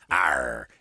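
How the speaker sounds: low pirate arr; pirate growl. Retold or imitated. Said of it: "talk like a pirate".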